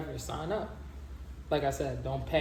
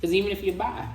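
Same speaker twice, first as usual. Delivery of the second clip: louder and higher, pushing the point; emphatic; 7 semitones higher, 7 dB louder, faster than usual. Real, not acted. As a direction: hit it hard the first time. Emphatic first take.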